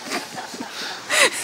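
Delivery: unvoiced high laugh burst; breathy laugh. Real, not acted. Lecturer amused by the machine translation, breathy laugh. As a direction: laugh out of breath at the absurd line.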